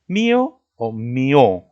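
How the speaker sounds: first MÍ-o, then mi-Ó, both loud and drawn out; stress shown both ways. Shown as a demonstration. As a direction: contrast MÍO on the i with mi-Ó on the o. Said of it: "¿Tú cómo mencionas esta palabra?".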